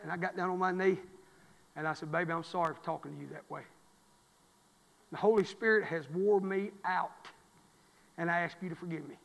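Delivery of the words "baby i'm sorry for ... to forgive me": very soft, low, hushed plea; tender apology. Retold or imitated. Husband retelling his apology to his sick wife, softly. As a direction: kneel and ask forgiveness gently.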